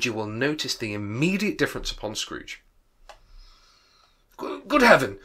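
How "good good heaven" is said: high, loud cry of wonder; excited exclamation. Acted. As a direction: burst out in astonishment. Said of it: "said Scrooge".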